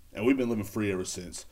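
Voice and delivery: ordinary delivery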